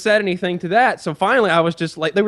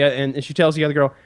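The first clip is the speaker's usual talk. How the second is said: ordinary delivery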